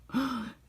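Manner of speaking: mock shocked gasp, very high; a sudden little high gasp; high sharp gasp. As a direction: gasp in play-acted shock. Villain realizes the finger hold.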